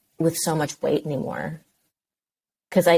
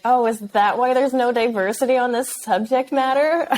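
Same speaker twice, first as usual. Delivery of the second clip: higher, louder mock-innocent question; sarcastic retort; 7 semitones higher, 3 dB louder than usual. To a movie producer who told her that, a sarcastic higher retort. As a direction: needle him with a sweet fake question.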